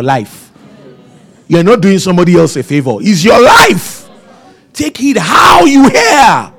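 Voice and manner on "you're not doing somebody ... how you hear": loud, high, slowed preaching shout; fiery preacher's cry. Acted. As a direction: drive it home to the congregation. Preaching at full force.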